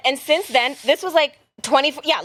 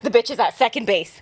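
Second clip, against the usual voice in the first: ordinary delivery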